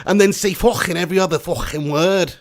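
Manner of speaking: loud, hammed-up Scouse; Scouse accent. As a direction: lean hard into a comic Scouse. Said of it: "All you gotta do to speak Scouse".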